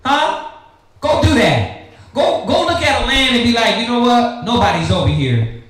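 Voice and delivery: ordinary talk, nothing marked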